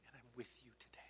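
soft, hushed words of comfort; tender whisper. Retold or imitated. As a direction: whisper it like a promise to a child. God's reassurance, tearfully retold.

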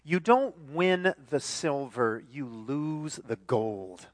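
slowly, weighing each word; slow emphatic quote. Shown as a demonstration. Preacher reading the ad slogan, slow and weighty. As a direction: let the slogan sink in slowly. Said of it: "this is what it says".

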